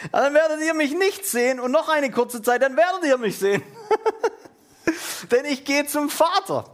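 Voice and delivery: high-pitched reading broken by laughter; laughing reading. Real, not acted. Preacher amused by Jesus' riddle to disciples, laughing. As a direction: keep reading the verse through real giggles.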